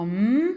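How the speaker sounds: m hummed as a long "mmm"; letter sound. Shown as a demonstration. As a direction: isolate the m as a steady hum. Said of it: "on entend". (m isolé, « mmm » prolongé)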